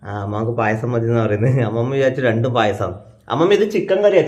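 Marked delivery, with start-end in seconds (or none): 3.3-4.2 raised voice, spelling it out for grandma; loud calling. Real, not acted. Grandson telling grandma it is chicken curry, loud calling. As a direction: call out high and loud.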